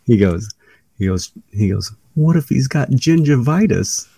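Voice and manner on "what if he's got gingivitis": high, comic worried squeak; funny high-pitched voice. Retold or imitated. Bray Wyatt objecting to Triple H, funny high-pitched. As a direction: imitate him asking in a silly high voice. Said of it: "this real funny, high-pitched voice".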